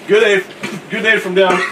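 tentative first go at Australian; hesitant, lightly put-on Aussie accent. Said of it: "start trying to speak an Australian accent".

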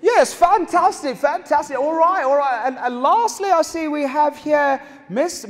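ordinary talk, nothing marked

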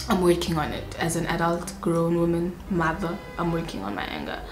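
soft, low, sheepish confession; quiet admission. Real, not acted. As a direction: say it under your breath. Admitting anger quietly.

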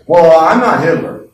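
loud, offended retort; indignant protest. Acted. A listener protesting, loud offended retort. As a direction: bristle like you've been insulted.

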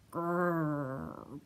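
deep grumbling growl, low; bear growl. Acted. Grumpy bear growling at Fox. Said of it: "growled Bear".

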